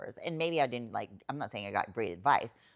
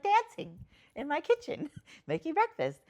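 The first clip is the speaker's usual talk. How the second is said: much higher, louder and laughing; bubbling over with joy; giddy reply; 5 dB louder than usual. Retold or imitated. Telling her sister what she is doing, high giddy laughing.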